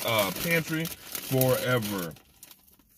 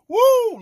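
high, loud whooping woo; 10 dB louder than usual. Acted. Host whooping loudly to open his video. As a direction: let out a big celebratory woo. Vlog intro hype greeting.

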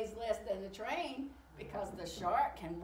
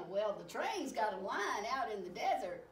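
plain reading voice

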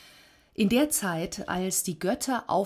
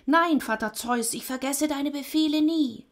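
high, thin creature voice; thin voice of Death; 8 semitones higher, 3 dB louder than usual. A narrator's reading. Death promises Zeus obedience, thin-voiced. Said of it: "die dünne Stimme des Todes".

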